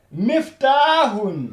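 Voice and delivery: "Miftahun" drawn out, slow; slow model word. Shown as a demonstration. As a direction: model "Miftahun" slowly for the class. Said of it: "Regardez très bien". (« Miftahun » articulé lentement)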